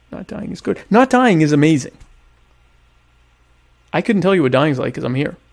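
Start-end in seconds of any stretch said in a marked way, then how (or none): 0.1-0.8 whispering. Real, not acted. Aside after surviving hurricane. 0.9-2.0 excited exclaim. Real, not acted. Exclaims after surviving hurricane.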